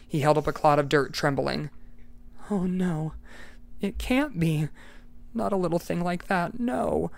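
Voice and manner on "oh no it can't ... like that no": whisper-close horror, lightly acted; dismayed murmur. Eccles horrified at the butterfly.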